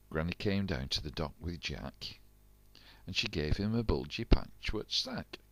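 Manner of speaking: usual speaking voice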